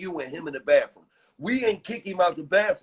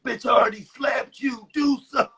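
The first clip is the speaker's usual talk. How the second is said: high, pitched-up challenge; retold shout; 8 semitones higher than usual. S1 retelling daring the guy after slapping him, high shout. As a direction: dare him to swing, shouting. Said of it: "I'm like".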